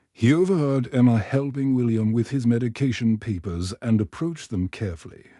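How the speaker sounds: ordinary delivery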